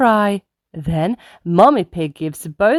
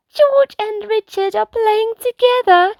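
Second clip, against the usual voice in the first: much higher, delighted cheer; cheering Peppa. Acted. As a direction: cheer for the little brothers. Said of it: "cheers Peppa".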